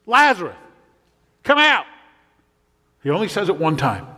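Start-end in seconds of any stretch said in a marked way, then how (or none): 0.0-1.8 high, forceful command, building higher; loud cry. Acted. Preacher voices Jesus calling into the tomb, loud cry. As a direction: call someone out with a loud voice. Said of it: "with a loud voice".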